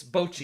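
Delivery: "Bocchi" said "BOH-chee"; long O. Shown as a demonstration. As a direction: stress the oh in "Bocchi". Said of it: "It's O".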